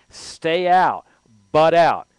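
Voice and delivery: a voiced public calling out, loud and firm; emphatic retold command. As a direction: bark it like a citizen telling the army to go. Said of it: "Americans have a tradition of saying".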